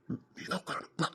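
coaxing whisper. Acted. Man coaxing the crying girl to sit, whispered. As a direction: whisper it close to her ear.

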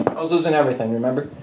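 ordinary talk

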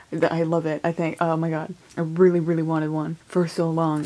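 ordinary delivery

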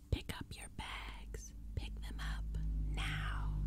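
far-off, hissing pumpkin monster; growling creature whisper. Acted. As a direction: hiss the orders like a ghoul. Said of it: "one of them growled".

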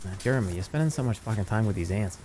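put-on low Boston-accented nagging; concerned Boston guy. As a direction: lecture a buddy as the Boston guy.

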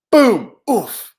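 loud boom, then a grunted oof; crash landing. Acted. Hero crash-landing on the planet, boom and oof. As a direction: impact and winded grunt.

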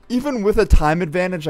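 usual speaking voice

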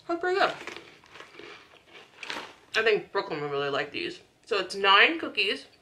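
speaking between bites; talking while chewing. Real, not acted. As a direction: mouth partly full of cookie. Seen 1: Bitten cookie in hand, jaw working; another piece eaten near 15.7.

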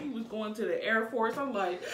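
normal talking voice

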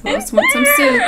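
very high, loud mock host cry; shrill mock announcer. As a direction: belt it like a silly host. Imitating the governor mockingly.

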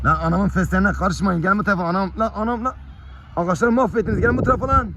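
high-pitched telling-off; scolding call. Real, not acted. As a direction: call them away from the plants, voice pitched well up. Scolding puppies wrecking the basil, calling them. (tiz sesle azarlayarak çağırma)

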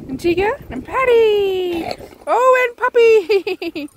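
high, gleeful pet-greeting voice; excited cooing. Real, not acted. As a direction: coo the names high, stretching them out. Greeting dogs fondly at treat time.

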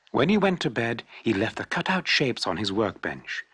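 usual speaking voice

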